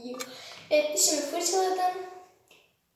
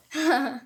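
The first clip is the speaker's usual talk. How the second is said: short laugh; 3 dB louder than usual. Real, not acted. Laughing after joy.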